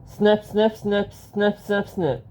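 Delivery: usual speaking voice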